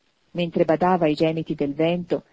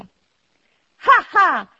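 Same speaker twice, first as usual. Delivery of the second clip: high, loud acted ha-ha; hearty laugh; 11 semitones higher, 9 dB louder than usual. A narrator's reading. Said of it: "rideva il nipote di Scrooge".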